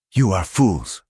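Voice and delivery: hoarse rasp of a beaten man. A narrator's reading. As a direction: rasp it out through the pain. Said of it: "Cal rasped".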